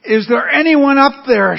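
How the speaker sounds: high, loud cry for help up to someone unseen; calling out. Acted. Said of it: "and then she called out".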